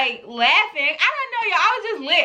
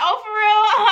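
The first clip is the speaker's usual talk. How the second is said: higher-pitched, amused and laughing; laughing reply; 5 semitones higher than usual. Retold or imitated. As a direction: react through a laugh. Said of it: "So I'm laughing".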